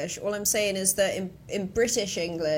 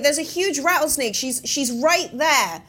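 retold yell; 7 semitones higher, 6 dB louder than usual. Hiker repeats the snake warning to the woman, retold yell. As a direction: re-enact calling out the danger. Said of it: "I sort of yelled to her".

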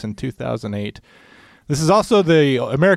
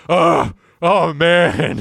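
slow, strained groan of heavy lifting; straining effort; 5 semitones higher, 6 dB louder, much slower than usual. Pretend. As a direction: grunt it out like hauling a heavy stone. Imagined killer lugging tombstone.

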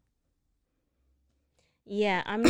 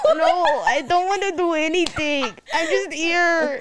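higher, louder, half-laughing refusal; laughing protest; 5 semitones higher, 6 dB louder than usual. Real, not acted. Co-host refusing the accent attempt, laughing protest. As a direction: plead through laughter.

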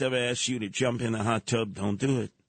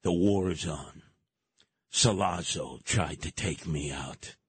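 mock gangland menace; grave mobster voice; 7 semitones lower than usual. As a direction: announce the war solemnly. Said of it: "He said".

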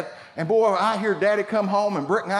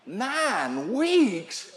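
NINE WEEKS drawn out with a high swoop on each word; mock disbelief, pitch swinging high; incredulous echo; 6 semitones higher than usual. Husband balks at wife's nine-week suspension, incredulous.

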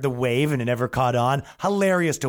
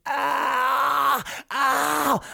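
high, pained whimpering moans; mock hurt moaning on the ground; Grape Lady moan; 12 semitones higher than usual. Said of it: "literally like Grape Lady".

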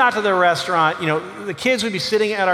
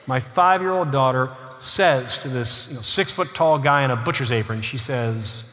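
usual speaking voice

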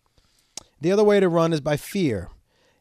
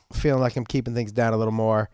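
usual speaking voice

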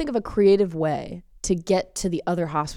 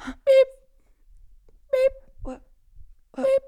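monitor beep; 3 dB louder than usual. Pretend. Imitating a heart monitor.

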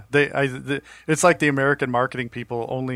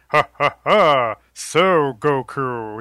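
slow, louder mock-haughty villain laugh; Cell dub voice; 5 dB louder, much slower than usual. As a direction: drawl it like a smug cartoon villain. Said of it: "Cell's voice".

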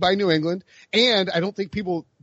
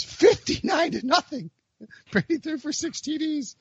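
laughing disbelief; 10 semitones higher, 3 dB quieter than usual. Real, not acted. Blowout score, laughing disbelief.

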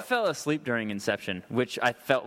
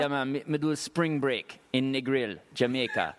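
mock Jamaican patois; Jamaican accent. Shown as a demonstration. Comic answering host about spring break in Negril, Jamaican accent. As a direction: put on an easy Jamaican lilt. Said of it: "this is how I know how to do Jamaica".

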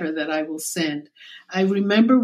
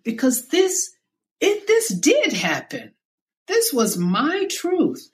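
crushed; 7 semitones higher than usual. Real, not acted. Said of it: "but I was crushed".

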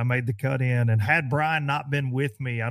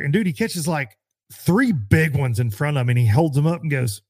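ordinary talk, nothing marked

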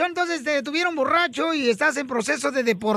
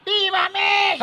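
grito patriótico; 7 semitones higher than usual. Pretend. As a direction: cry it out like a fiesta toast. Mock drunken patriotic cheer. (grito festivo de ¡viva!, agudo y alargado)

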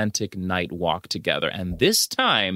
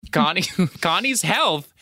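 laughing while quoting; 7 semitones higher than usual. Retold or imitated. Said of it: "And Beehive says".

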